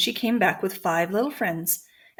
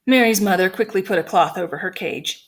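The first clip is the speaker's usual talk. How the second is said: usual speaking voice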